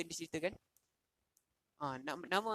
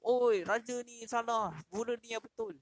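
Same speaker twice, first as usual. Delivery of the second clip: crowd outcry; 5 semitones higher, 3 dB louder, slower than usual. Retold or imitated. Crowd denounces the king.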